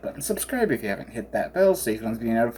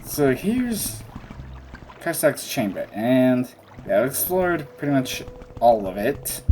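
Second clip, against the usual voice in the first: ordinary talk, nothing marked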